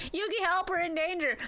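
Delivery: put-on high urgent girlish plea; mock Dub Taya. Dub Taya calling Yugi for help, high mock plea. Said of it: "immediately goes".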